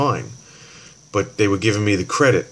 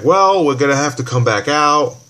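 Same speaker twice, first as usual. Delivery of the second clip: higher, louder quote of the technician; repair guy's line; 6 semitones higher, 6 dB louder than usual. Retold or imitated. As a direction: voice the technician's news. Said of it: "the guy's like".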